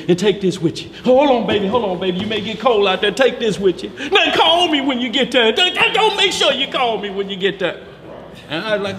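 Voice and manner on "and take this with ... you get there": high, doting mother's goodbye; fussing mother. Retold or imitated. Mother fussing as her son leaves, high and doting. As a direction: fuss over him like a worried mama. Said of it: "She giving him everything".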